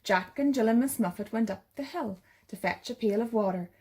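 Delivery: ordinary delivery, nothing marked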